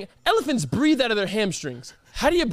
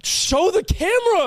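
high, drawn-out shout; loud demand; 12 semitones higher, 5 dB louder, slower than usual. Real, not acted. Host demands cohost show his dirty socks, high loud shout. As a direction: order it loudly, pitched way up.

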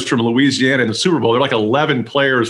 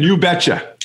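put-on Minnesota accent; 5 semitones higher than usual. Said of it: "the two I really like is".

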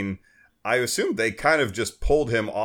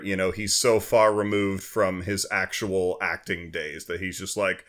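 ordinary delivery

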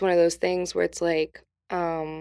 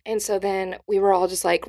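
ordinary talk

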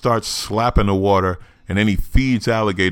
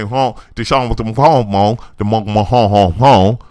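garbled mock Cajun mumble, growing louder; mock Swamp People Cajun; 3 semitones higher, 6 dB louder than usual. Host mocking how Swamp People talk, garbled mock Cajun honking. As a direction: mangle it like a Cajun swamp hunter, building in volume. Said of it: "you know that's how they talk".

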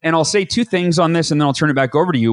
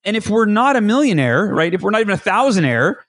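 ordinary talk

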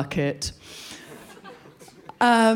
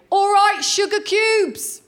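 loud, high shout from above; builder calling down; 10 semitones higher, 4 dB louder, slower than usual. Retold or imitated. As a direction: holler it down to someone in the street. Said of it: "a voice from on high say".

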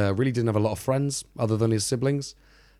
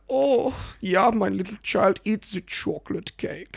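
doting mother; 10 semitones higher, slower than usual. Retold or imitated. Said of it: "And she'd be all like".

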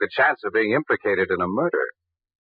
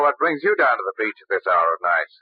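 normal talking voice

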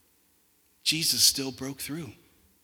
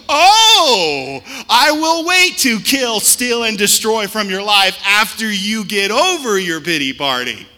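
much higher and louder, slow and sneering; mock enemy voice; 6 semitones higher, 14 dB louder than usual. Pastor voices the enemy taunting a self-pitying believer, high sneer. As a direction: play the devil sarcastically waiting you out.

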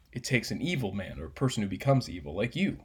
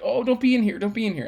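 high, louder mock-panicked quote; imitating urgent shooing; 12 semitones higher, 7 dB louder than usual. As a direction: mimic her shooing someone out, pitched way up. Retelling her frantic shooing.

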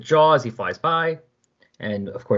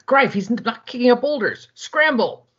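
urgent warning; 12 semitones higher than usual. Acted. Soldier warns of boulders.

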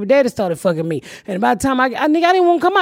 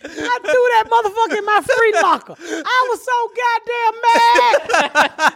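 hammed-up hurt, half crying; mock anguish; 11 semitones higher than usual. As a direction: wail it like a wounded kid. Angry betrayal over book bag.

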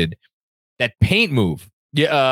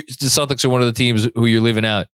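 usual speaking voice

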